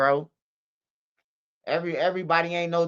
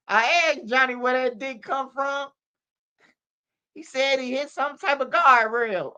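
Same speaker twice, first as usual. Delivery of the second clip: mama's side; 7 semitones higher than usual. Retold or imitated. Mama telling her side, imitated.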